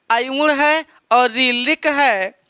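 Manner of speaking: आयुण है और रीलिक है said slowly, syllable by syllable; slow it down so students can repeat along; slow recitation. Shown as a demonstration. Teacher leads students through sutras, slow and clear. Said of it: "मन में बोलते रहिए".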